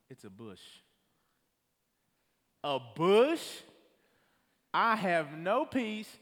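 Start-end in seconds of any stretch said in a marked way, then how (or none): 2.6-3.4 loud, incredulous question; loud disbelief. Real, not acted. Telling the congregation the face was a bush, loud and incredulous. 4.7-6.1 exasperated. Real, not acted. Exasperated preaching punch.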